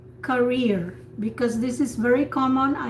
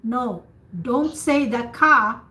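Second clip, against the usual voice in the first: normal talking voice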